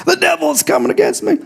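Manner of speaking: very high, mock-alarmed whine; mock persecuted believer. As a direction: overplay a believer blaming the devil. Mocking over-dramatic persecuted believer.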